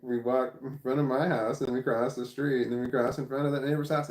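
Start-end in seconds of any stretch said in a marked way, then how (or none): none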